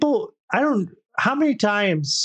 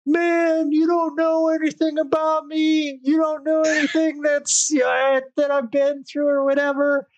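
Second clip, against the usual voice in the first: much higher whining imitation; whiny mock voice; 12 semitones higher than usual. S0 mocks the bat guy whining he's misunderstood, much higher. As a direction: whine it like a self-pitying stranger, way up high.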